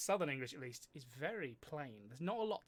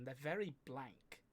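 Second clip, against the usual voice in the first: normal talking voice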